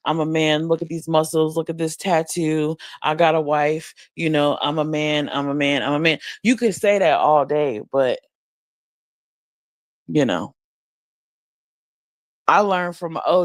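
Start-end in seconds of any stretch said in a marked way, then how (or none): none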